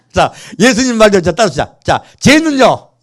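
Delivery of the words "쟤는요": high, loud whining jab; mock jealous Peter. Shown as a demonstration. Pastor demonstrating Peter's jealous line for the congregation, high loud whine. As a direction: throw the line out for the crowd to copy. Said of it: "다시 제가 할게요".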